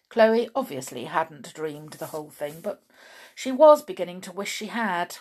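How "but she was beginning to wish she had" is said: usual speaking voice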